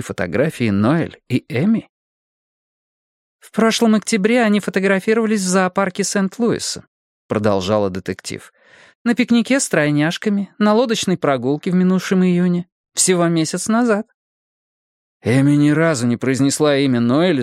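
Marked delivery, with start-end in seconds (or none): 3.4-14.1 high-pitched detective voice; detective Bonnie, pressing on. Acted. Detective lists photo occasions to husband, pressing higher voice. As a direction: list the evidence in a higher voice.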